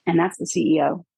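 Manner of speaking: ordinary talk, nothing marked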